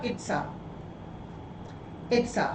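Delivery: "it's a" run together as one word; chunked words. Shown as a demonstration. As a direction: join the s of "it's" straight onto "a". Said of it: "that is called chunking".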